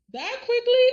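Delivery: high-pitched squeal of disbelief; incredulous. Retold or imitated. Viewer reacting to Bill turning to Brooke so quickly, incredulous. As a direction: shoot the question way up high.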